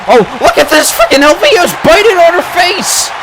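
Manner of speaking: high-pitched outraged call; shocked excited yell. Real, not acted. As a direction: yell it in disbelief, fast. Shocked at a bite in match.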